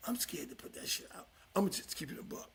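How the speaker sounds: hushed, breathy whisper; whispered confession. Real, not acted. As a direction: whisper it like a guilty secret. Confessing fear to post footage.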